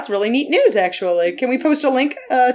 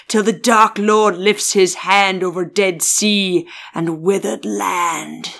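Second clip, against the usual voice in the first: put-on low, slow old pirate voice; Jeffrey Rush; 4 semitones lower, slower than usual. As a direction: intone it like a grim old pirate. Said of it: "It sounded like doing Jeffrey Rush".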